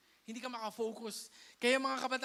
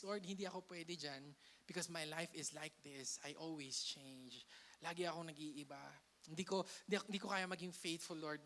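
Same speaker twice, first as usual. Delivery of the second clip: soft, low, unworthy-sounding prayer; humble confession; 5 semitones lower, 7 dB quieter than usual. Acted. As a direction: admit your failings quietly to the Lord. Voicing a doubting churchgoer's prayer.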